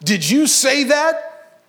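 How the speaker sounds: high, slow, incredulous demand; hammed-up suspicious question to a parrot; hissed question. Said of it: "he hissed at the parrot".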